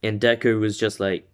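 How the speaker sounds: ordinary delivery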